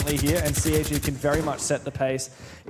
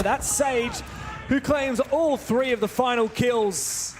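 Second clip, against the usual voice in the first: higher, rising live commentary; excited play-by-play; 6 semitones higher than usual. Acted. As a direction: call the action as it happens. Play-by-play of the round.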